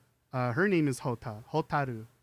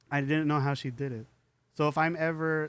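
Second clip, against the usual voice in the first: normal talking voice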